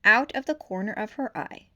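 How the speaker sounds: plain reading voice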